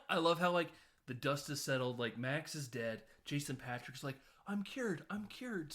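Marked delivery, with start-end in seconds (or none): 4.5-5.7 high-pitched mock-joyful cry; mock relief. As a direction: imitate a relieved hero, pitched well up. Retold hero cheering his cure.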